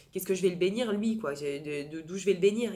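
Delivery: loud, self-doubting rhetorical question; imitated incredulous protest. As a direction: play her disbelief at being asked, forcefully. Acts out woman's disbelief at request.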